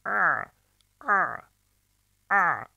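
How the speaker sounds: tight-throated ayn sound; squeezed ayn. Shown as a demonstration. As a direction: squeeze the throat for a thin strained "a". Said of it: "ince ve sıkılmış bir ses çıkartacağız".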